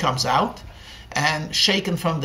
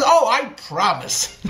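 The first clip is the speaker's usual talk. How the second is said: put-on playful, higher-pitched girlish promise; a hint of a smitten young girl; lightly voiced eager girl; 5 semitones higher than usual. A narrator's reading. Girl eagerly promises marriage, hammed up.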